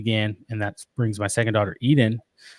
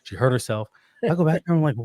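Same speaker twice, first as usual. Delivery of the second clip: ordinary talk, nothing marked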